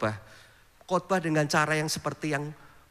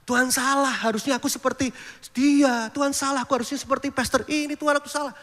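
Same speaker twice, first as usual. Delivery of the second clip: whiny complaint; 7 semitones higher than usual. Retold or imitated. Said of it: "kita sedang mengkritik rencana Tuhan".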